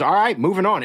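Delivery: imitating a director calling out. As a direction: briskly announce the move to the set. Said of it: "Waxman goes".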